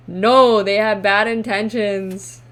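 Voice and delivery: loud, forceful warning; emphatic refusal. Acted. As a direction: voice the cards' answer with a booming No. Acts out the cards' blunt answer.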